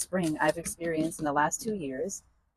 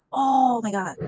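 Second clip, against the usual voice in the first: dismayed exclamation; 5 semitones higher, 5 dB louder, slower than usual. Real, not acted. After dumping the water.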